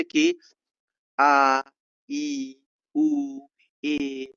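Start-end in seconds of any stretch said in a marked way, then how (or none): 1.2-1.6 आ drawn out loud; long vowel demo. Shown as a demonstration. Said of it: "आ तुरंत नहीं कि". 2.1-3.4 ई and उ stretched high; long vowel demo. Shown as a demonstration. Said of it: "जिसे थोड़ा बोलते खींच कर".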